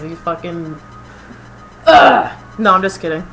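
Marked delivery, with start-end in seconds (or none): 1.9-2.4 loud, voiceless exasperated groan; exasperated burst of breath; frustrated ugh. Real, not acted. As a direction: let out a harsh ugh of disgust. Cuts off rant about the manager.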